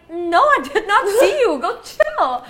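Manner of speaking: high, loud mock protest with a laugh; exasperated retort. Other girl answering an accusation, exasperated retort. The girl's retold exasperated reply.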